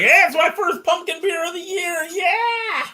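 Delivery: high excited yell of joy; excited whoop. Retold or imitated. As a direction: relive the thrill of the first pumpkin beer. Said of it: "I was like".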